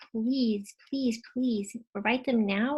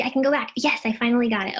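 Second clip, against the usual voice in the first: louder, quicker cry of success; triumphant relief; 10 dB louder, faster than usual. Real, not acted. Presenter finally getting the tool working, triumphant. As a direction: announce the victory over the tech. Fixes the screen at last.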